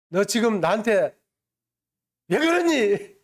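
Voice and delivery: mother's mock scolding, pitch jumping up at the end; imitated angry yell. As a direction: act out an exasperated mother raising her voice. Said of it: "이렇게 하고 소리를 질렀어요". (화내며 소리치는 어머니 흉내)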